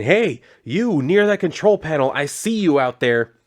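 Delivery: half-shouted retold call to someone out there; retold yell. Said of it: "So she yells out again".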